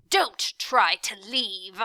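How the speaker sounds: slow, loud command; a menacing villain orders them not to leave. A narrator's reading. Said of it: "he ordered".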